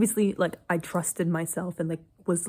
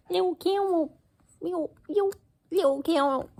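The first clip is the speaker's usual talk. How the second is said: much higher, cooing at the little candle; squeaky cutesy voice over a candle; 11 semitones higher than usual. Real, not acted.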